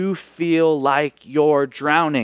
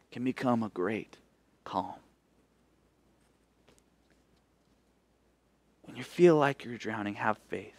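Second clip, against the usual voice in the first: soft closing; 6 semitones lower, 11 dB quieter than usual. Real, not acted. Closing hushed reflection.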